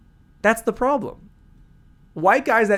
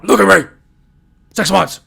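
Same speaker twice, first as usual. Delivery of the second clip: very loud, abrupt yelling; mock desperate shout; 3 semitones higher, 10 dB louder than usual. Comic to girl at a bar after long dry spell, mock shout. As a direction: yell it out as a hammed-up plea.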